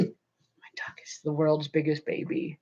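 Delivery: soft whispered aside about the dog; hushed aside about the needy dog. Real, not acted. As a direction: breathe it out under the breath.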